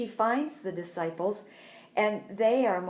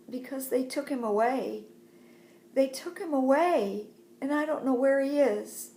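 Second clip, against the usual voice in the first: higher, lightly acted distress; mildly distressed plea; 5 semitones higher, 4 dB louder than usual. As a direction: plead with a touch of upset, pitch raised. Crying Mary answers the angels.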